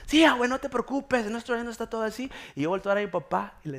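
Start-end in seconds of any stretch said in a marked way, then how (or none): 0.1-2.3 high, eager kid imitation; children reassuring. Grandchildren reassuring grandma about depth, acted high voice. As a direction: brush off worries like a child.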